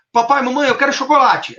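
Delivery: put-on high-pitched pleading kid; lightly acted child begging the parents; little child. Small son asks parents for chocolate, childlike. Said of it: "o seu filhinho pode tá falando".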